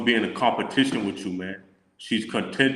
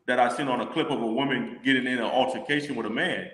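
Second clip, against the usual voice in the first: normal talking voice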